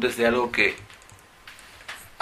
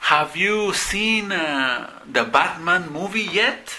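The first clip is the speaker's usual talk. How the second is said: slow model question; 7 semitones higher, much slower than usual. Shown as a demonstration. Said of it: "si yo digo".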